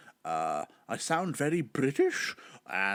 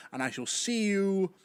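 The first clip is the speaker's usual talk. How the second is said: ordinary talk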